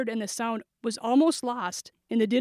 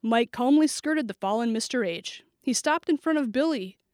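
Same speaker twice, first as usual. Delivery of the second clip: ordinary delivery, nothing marked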